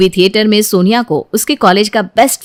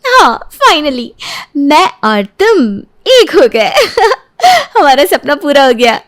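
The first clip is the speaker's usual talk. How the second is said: tearful happy laugh; 6 semitones higher than usual. Acted. Bride overjoyed after wedding.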